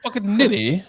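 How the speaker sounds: high mock British insult; British accent. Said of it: "give me your British accent".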